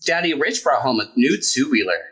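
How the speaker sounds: plain reading voice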